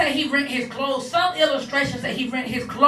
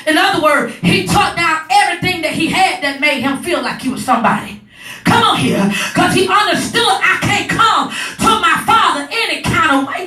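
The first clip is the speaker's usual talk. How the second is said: raised, loud, driving sermon cadence; higher and louder gospel preaching; preaching at full force; 4 semitones higher, 4 dB louder than usual. Acted. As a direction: preach it, building the fire.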